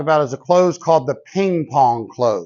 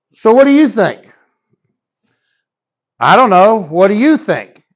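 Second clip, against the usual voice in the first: louder mock back-and-forth of an unsure couple; undecided couple; 3 semitones higher, 4 dB louder than usual. Salesman acting out a hesitant couple, louder mock dialogue. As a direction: toss the question back and forth, a bit louder.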